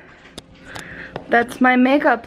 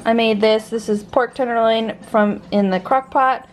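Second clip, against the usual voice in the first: ordinary talk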